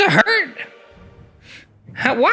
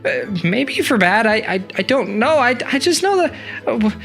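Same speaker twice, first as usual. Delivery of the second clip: much higher, stammering worry; confused panic. Acted. Tisha unsure of her purpose, high anxious stammer. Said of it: "And little Tisha's like".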